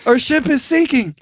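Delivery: replaying the ad. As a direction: run both voices together in fun. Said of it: "what are you thinking about".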